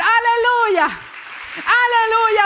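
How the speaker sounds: high, loud cry of aleluya; shouted hallelujah. Real, not acted. Pastor leading the church in praise, loud shout.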